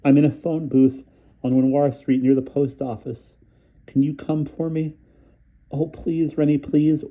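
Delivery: injured woman begging a doctor to come; frantic begging. A narrator's reading. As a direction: beg him desperately to come. Polly panicking, begging for help.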